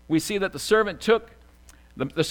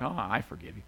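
low, subdued, reluctant forgiveness; mock-somber, soft and heavy; 7 semitones lower, 10 dB quieter than usual. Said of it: "And not somberly like".

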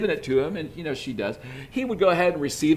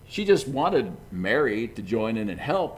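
ordinary talk, nothing marked

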